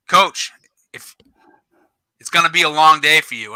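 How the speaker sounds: measured warning with pauses, lightly acted; retold ref's warning. Ref warning the coach on the field, retold warning. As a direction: imitate a ref cautioning a coach. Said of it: "he goes".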